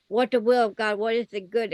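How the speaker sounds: usual speaking voice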